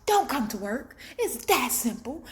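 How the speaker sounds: high, loud, indignant rant; loud and fed up, scolding a sick coworker; sassy rant. Retold or imitated. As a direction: tell her off, full of attitude. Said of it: "And I'm just like".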